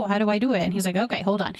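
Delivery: normal talking voice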